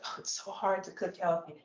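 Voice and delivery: quiet whiny mocking of an excuse; mock whiny complaint. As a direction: sigh it out like a lazy excuse. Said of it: "It's not hard to cook healthy".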